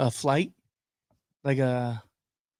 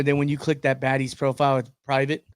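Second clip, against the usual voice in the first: normal talking voice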